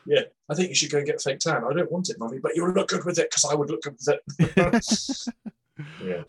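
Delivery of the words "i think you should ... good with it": high, hurried pushy-mother parody; stage mum. Acted. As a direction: imitate a nagging pageant mum, pitched up.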